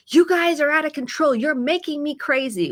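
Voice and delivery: mock yell at unruly kids; dysregulated yelling. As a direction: snap at the kids, losing control. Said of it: "not regulated and they go".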